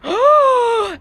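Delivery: sharp loud gasp; a sudden, loud, high gasp; shocked gasp. Real, not acted. Reacting to alpine divorce.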